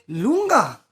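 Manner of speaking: loud, high call of his name in disbelief; shocked call of a name. Retold or imitated. To the radio host who phoned, a loud shocked cry. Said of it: "I did this".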